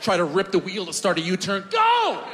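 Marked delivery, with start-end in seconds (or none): 1.7-2.2 yelled command. Retold or imitated. Yanking the wheel for U-turn.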